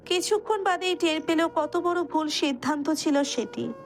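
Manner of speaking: ordinary talk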